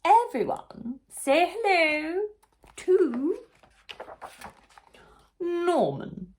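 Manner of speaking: high, loud, slow teacherly prompt; queen bee. Acted. Queen bee prompting the class to greet Norman. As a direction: lead the class like a beaming queen. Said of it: "the queen".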